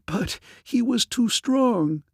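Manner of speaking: very high, slow lament; sobbing despair. A narrator's reading. Captive woman admitting defeat to the thief, tearful despair. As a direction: draw it out high, as if in tears. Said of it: "began to sob uncontrollably".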